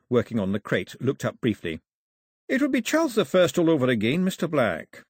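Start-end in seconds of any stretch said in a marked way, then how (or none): 2.5-5.0 lightly voiced workman, higher pitch; raised pitch, addressing a colleague respectfully; Mister Brown's deferential agreement.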